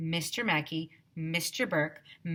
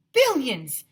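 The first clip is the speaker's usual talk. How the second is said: higher, louder cry; shouted; 7 semitones higher, 8 dB louder than usual. A narrator's reading. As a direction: outbid the last guess. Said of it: "shouted Miss Moll".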